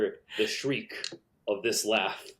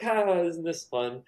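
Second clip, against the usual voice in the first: higher, slow mock-gleeful voice; playful teen; 6 semitones higher, slower than usual. Teens goofing with boxes, mock gleeful. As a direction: toss it off like a kid goofing around. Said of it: "tossing boxes at each other, like".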